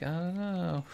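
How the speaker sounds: high drawn-out hummed shrug; mock clueless character. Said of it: "My guy's like".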